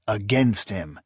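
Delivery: usual speaking voice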